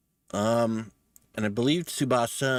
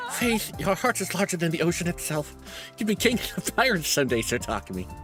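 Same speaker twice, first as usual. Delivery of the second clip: theatrical character voice; 7 semitones higher than usual. Acted. Voicing Kyoshika, theatrical.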